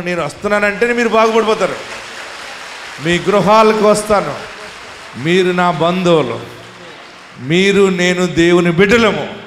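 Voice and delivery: loud, rising preacher's promise; full-force preaching. Acted. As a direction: proclaim it to the whole hall.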